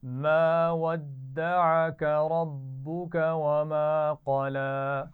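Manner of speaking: teacher chanting Quran for students to echo; Quranic recitation. Shown as a demonstration. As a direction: model the verse slowly for the class to repeat. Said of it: "मेरे बाद पढ़िए".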